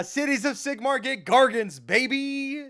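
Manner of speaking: loud, high-pitched hype; excited cheering. Real, not acted. Fan celebrating Gargants for Cities of Sigmar, excited cheer. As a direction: crow it like a thrilled fan. Cheering the news for their army.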